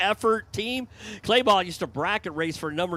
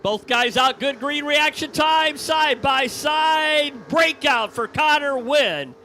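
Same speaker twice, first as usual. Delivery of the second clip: shouted, drawn-out call, higher and louder; excited race call; 6 semitones higher, 6 dB louder, slower than usual. Real, not acted. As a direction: yell side by side as they race. Live race call, side by side.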